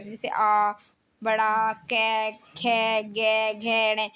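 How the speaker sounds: slow, drawn-out throat letters "कै, खे, गे"; sounding out letters. Shown as a demonstration. Teacher shows students the throat letters by sounding them. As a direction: voice each letter separately. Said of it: "ये आप बोल के देखिए".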